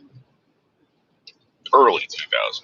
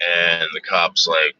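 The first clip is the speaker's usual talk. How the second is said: normal talking voice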